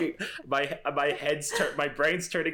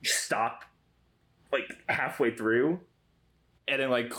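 ordinary talk, nothing marked

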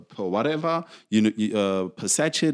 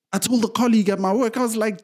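ordinary talk, nothing marked

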